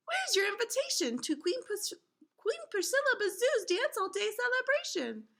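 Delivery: high, squeaky bee voice; guard bee. Acted. Guard bee questioning the visitors, high and squeaky.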